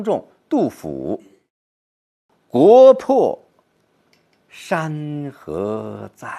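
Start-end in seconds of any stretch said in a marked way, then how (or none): 2.5-6.3 国破重读，山河在放慢; 国破 loud, 山河在 slowed. Shown as a demonstration. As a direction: punch 国破, slow down 山河在 with pain. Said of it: "是放慢的".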